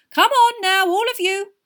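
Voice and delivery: very high, loud chivvying; breaking up argument. A narrator's reading. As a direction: herd everyone out briskly. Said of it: "breaking up the argument".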